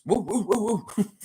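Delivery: high-pitched "woo woo" hoots; playful whooping. Pretend. Streamer hyping up Raptors fans, playful whooping. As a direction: whoop it up in quick high bursts.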